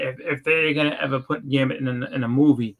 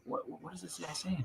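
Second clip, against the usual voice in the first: very soft, higher-pitched puzzled aside; baffled undertone; 4 semitones higher, 18 dB quieter than usual. Retold or imitated. Listener puzzled by a thick accent, hushed. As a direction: say it under your breath, baffled.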